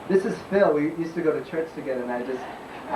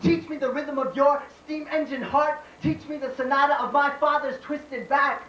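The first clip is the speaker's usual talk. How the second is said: spoken-word poem; 13 semitones higher than usual. Acted. Poet tells America of a mother's hands, declaimed spoken-word poem. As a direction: slam poet's raised-pitch declaiming.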